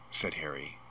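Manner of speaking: usual speaking voice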